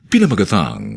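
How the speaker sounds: ordinary talk, nothing marked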